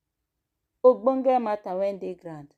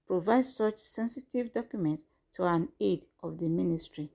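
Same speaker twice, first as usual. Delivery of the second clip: measured, formal news read; formal statement reading; 4 dB quieter than usual. Acted. Reads out formal press statement.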